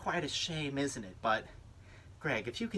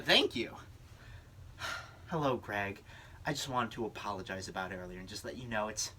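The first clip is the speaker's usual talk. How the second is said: ordinary delivery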